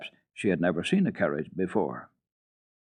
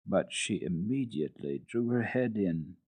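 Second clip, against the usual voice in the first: ordinary delivery, nothing marked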